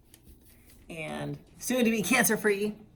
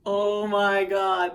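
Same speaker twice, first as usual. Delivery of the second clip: gushing; 9 dB louder, much slower than usual. Real, not acted. Overwhelmed by cat's cuteness.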